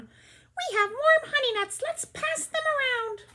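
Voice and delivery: high, slow squeaky gopher and mole voice; tiny burrowing critters, way up high and slow; small animal voices. Acted. Reader voicing Gopher and Mole offering honey nuts, high squeak. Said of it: "the animals have to have different voices".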